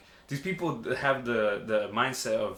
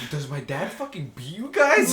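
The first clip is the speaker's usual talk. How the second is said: higher, louder, drawn-out disbelief; incredulous question; 5 semitones higher, 5 dB louder, slower than usual. Retold or imitated. Son asking his dad's scared employees, incredulous. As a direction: ask it slowly in comic disbelief.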